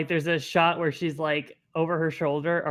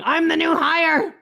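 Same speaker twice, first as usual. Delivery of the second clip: high, loud, drawn-out shout; screaming newbie; 13 semitones higher, 9 dB louder than usual. Acted. Podcaster mimicking an anime new hire bursting in, screaming. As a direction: burst in yelling with over-eager newbie energy. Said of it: "burst in screaming".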